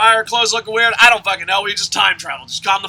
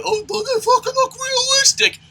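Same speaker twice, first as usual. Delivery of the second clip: high, soft whiny mock voice; mocking whiny critic; 11 semitones higher, 8 dB quieter than usual. Wrestling ranter mocking critics who call it fake, whiny. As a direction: sneer out the complaint in a prissy voice.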